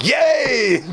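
high, hammed-up shout of joy; gleeful cheer. Husband delighted at his wife's insult, gleefully hammed-up. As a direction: cheer it like a mock celebration.

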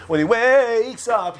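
very slow, stretched WAKES; drawn-out waking. Acted. Storyteller acting the patient waking, drawn-out WAKES.

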